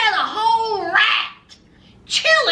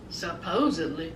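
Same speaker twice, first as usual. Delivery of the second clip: sarcastic aside; 12 semitones lower, 10 dB quieter than usual. Real, not acted. Sarcastic aside on part-time work.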